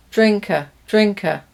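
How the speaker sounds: "drink or" run into "Drinker"; "or" swallowed into a schwa; weak form. Shown as a demonstration. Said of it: "We change the vowel in the weak forms".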